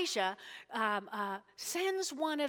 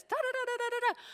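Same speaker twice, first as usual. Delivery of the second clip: high-pitched rapid babble; excited gabbling wife; 11 semitones higher, much faster than usual. Retold or imitated. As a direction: imitate a wife gabbling news in da-da syllables. Wife gabbling news to husband.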